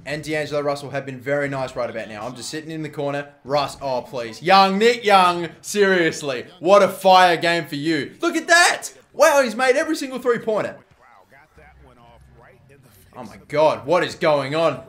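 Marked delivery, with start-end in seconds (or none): 4.4-10.8 loud, high, amazed game commentary; excited exclaiming. Real, not acted. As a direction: cheer your teammate's hot hand in disbelief. Amazed at teammate's shooting streak.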